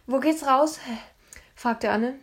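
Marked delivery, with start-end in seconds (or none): 0.1-0.8 loud, higher question; calling out. A narrator's reading. Anne lost in the dark moat, calling urgently. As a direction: call it out after a cough.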